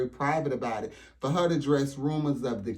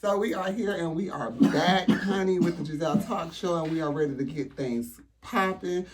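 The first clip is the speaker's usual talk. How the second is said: talk show host; 3 semitones higher than usual. Pretend. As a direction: open your pretend show like a big TV host. Mock talk show opening.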